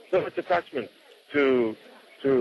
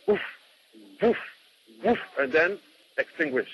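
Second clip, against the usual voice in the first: imitating a flame whooshing up; camphor flare noise. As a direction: puff out the flame flaring up. Camphor flame flaring up before going out.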